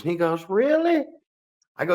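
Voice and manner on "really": squeaky high disbelieving really; a high put-on gasp of surprise; mock surprised high voice. The forgetful guy asks in disbelief, squeaky high.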